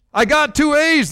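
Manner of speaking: bursting with pride over two A's; gleeful cry. A narrator's reading. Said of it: "the small boy cried ... filled with glee".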